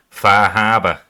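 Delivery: punched-out Boston accent demo. Shown as a demonstration. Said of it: "I've got Boston a little bit".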